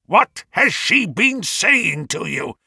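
high, slow, emphatic demand; furious scream. A narrator's reading. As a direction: hammer out each word in an old man's fury. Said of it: "screamed the old man furiously".

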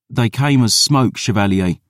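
youthful, insistent lad; earnest boy. Acted. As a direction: play a boy sure of his tale. Boy's voice.

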